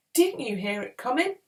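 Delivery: high, urgent demand; alarmed question. A narrator's reading. Urgent question amid a fight.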